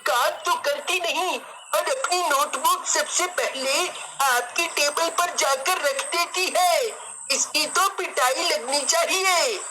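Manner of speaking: high, slow put-on boy's voice; whiny little tell-tale; tattling schoolboy. A narrator's reading. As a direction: drag out the words to get a classmate in trouble. Plays Rohan telling on Kosi.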